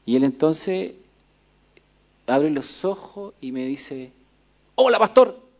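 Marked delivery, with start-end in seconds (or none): 4.8-5.5 loud, high, cheery call of the sick man; loud greeting. Retold or imitated. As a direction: imitate him calling out loudly. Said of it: "me habla fuerte".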